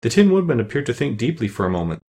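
ordinary delivery

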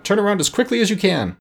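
high-pitched urgent plea; pressing, high call to hurry; urgent cry. A narrator's reading. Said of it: "cried Tip to the Gump".

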